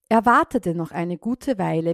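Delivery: usual speaking voice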